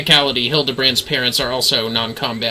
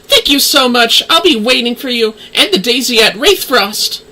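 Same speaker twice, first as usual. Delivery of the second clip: put-on higher, downcast young woman's voice; voiced NPC girl; 10 semitones higher, 3 dB louder than usual. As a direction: play the gloomy girl asking a favour, pitched up. Same NPC thanks the player.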